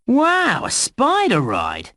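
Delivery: high, drawn-out wow of surprise; amazed wow. Acted. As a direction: marvel at it, stretching the words. Surprise at seeing a ride.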